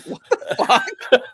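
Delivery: retelling her reaction, cracking up; repeated shocked reaction. Retold or imitated. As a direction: laugh as you replay her shock. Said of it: "She was like".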